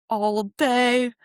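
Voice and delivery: ordinary talk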